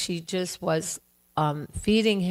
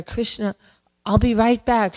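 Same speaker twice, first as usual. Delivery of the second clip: mother calling out, higher and louder; calling to son; 5 semitones higher, 5 dB louder than usual. Acted. Yashoda hurries to the stove, calling to Krishna, higher and louder. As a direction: call back over her shoulder, hurrying off. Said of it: "But she's there".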